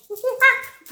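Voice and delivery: high, loud monkey-like hoots; monkey noises. Pretend. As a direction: playfully hoot like a monkey. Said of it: "On dirait un singe". (cris de singe pour rire)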